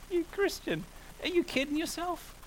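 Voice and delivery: mock the believer as the devil would; a hammed-up devilish sneer; Satan's taunt.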